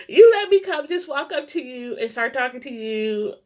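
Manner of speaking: emotional rush, voice on the edge of tears; fighting tears. Real, not acted. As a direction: gush gratitude while close to crying. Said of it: "I'm trying not to cry".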